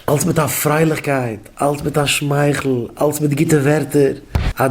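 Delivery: usual speaking voice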